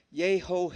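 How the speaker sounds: "Yehovah" in four drawn-out syllables, yei-ho-hei-vei; breathy syllabic pronunciation. Shown as a demonstration. Teacher showing the correct pronunciation, "Yehovah" slowly as yei-ho-hei-vei. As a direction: sound every letter of "Yehovah" with some breath. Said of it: "la pronunciación correcta es".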